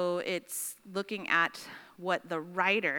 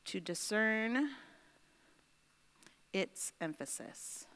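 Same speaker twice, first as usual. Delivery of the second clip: slow dictation; 2 semitones higher, 6 dB quieter, slower than usual. Real, not acted. Writing out while dictating aloud.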